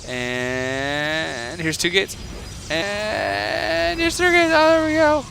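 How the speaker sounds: slow build from plain to a high excited shout on Nalza's gates; counting the warp gates, climbing from plain to a high excited shout; rising excitement. Real, not acted. Caster rising as gates appear.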